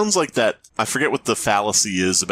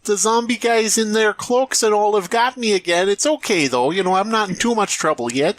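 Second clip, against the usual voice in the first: high, chirpy British damsel; a posh English girl, unbothered; high mock British accent; 9 semitones higher than usual. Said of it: "I'm British".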